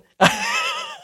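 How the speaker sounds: very high burst of laughter; high squealing laugh. Real, not acted. Laughs after mock complaint.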